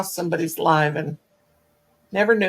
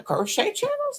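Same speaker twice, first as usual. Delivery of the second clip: incredulous question; 4 dB quieter than usual. Retold or imitated. Retold incredulous viewer.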